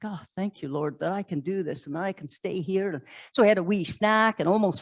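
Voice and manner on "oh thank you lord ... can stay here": soft, hushed prayer of thanks. Acted. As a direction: murmur a grateful prayer. Climber praying softly at view.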